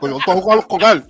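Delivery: fast, mushy Cuban Spanish; mumbled Cuban Spanish. Retold or imitated. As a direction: rush it out like a Cuban boxer with a full mouth. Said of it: "You sound like him".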